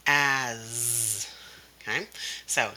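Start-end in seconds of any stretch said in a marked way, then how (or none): 0.1-1.3 As drawn out into a buzzing z. Shown as a demonstration. Teacher showing S copying the vowel, buzzed As. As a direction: hold the end of As as a voiced z. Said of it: "gonna sound like the Z sound".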